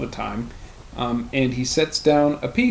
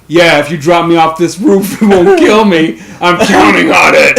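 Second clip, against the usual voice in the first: mock henchman; 3 semitones higher, 11 dB louder than usual. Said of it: "the equivalent of him being like".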